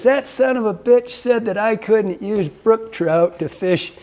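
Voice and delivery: a touch of mock outrage, high and slow; indignant old man. Retelling the old vet complaining about the warden, mildly indignant. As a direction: play the old vet griping about the law. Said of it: "He goes".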